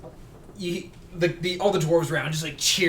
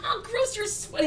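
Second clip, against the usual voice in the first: put-on much higher little girl's voice, grossed out; young tabaxi girl. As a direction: squeal it like a small kid disgusted by sweat. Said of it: "you see this very young, very small tabaxi".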